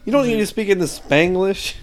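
hammed-up angry mom; mock scolding mom. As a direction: go off like a fed-up mother. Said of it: "going off on him".

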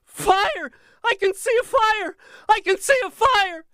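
frantic shrieking, very high and loud; piercing scream. A narrator's reading. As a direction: scream it out in terror. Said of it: "a piercing cry split the silence".

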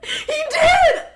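high, loud laughing shriek; real squeal of outraged amusement; shocked outburst. Real, not acted. Finds out she was unmatched, laughing.

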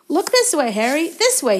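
high, loud eager cry; shrill cry. A narrator's reading. Colin calls to Harry from the stands, shrill and eager. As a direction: yell for attention like an excited fan. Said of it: "he cried shrilly".

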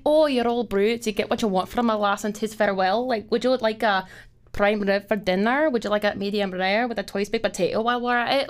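theatrical Scottish waitress; Scottish accent. Acted. Server showing off for a table, playful Scottish accent. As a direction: take the dinner order in a Scottish accent. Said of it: "I had to learn a Scottish accent".

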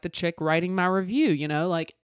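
usual speaking voice

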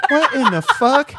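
incredulous through a laugh; laughing disbelief. Retold or imitated. Friend baffled by a guy cheering a kids movie, laughing.

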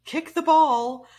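high, loud mock yell at a teammate; captain yelling across the field, mock shout. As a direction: bark it like an annoyed captain. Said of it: "he yells at me".

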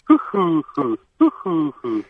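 a London pigeon cooing. Shown as a demonstration. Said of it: "Do the London one again".

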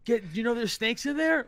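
high, loud alarmed warning. Retold or imitated. Orangutan warning the scientist about snakes, high loud alarm. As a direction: yell a warning to the man in the water.

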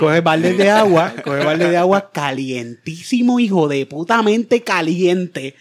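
slow, drawn-out emphasis; slow emphatic. Retold or imitated. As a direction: stretch each word out for weight. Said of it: "emphatic slow retelling".

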